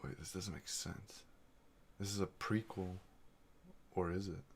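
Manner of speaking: ordinary delivery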